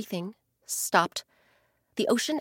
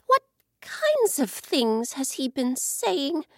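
worried; 6 semitones higher, slower than usual. Acted. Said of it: "the ocean grew worried".

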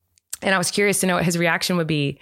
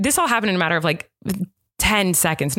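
ordinary delivery

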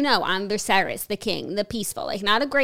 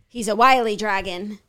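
usual speaking voice

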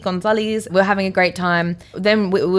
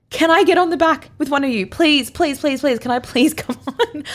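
put-on higher, pleading little-kid voice; begging like a kid; 7 semitones higher than usual. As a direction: beg like a child for a ride. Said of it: "literally like a little kid".

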